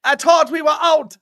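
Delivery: mock McLaren voice. Voicing McLaren in Jamaica.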